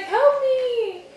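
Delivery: high, loud mock cry for help; hammed-up yell of help; panicked kid. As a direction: squeal like a scared swimmer. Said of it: "He's like".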